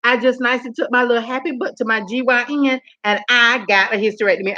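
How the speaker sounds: ordinary talk, nothing marked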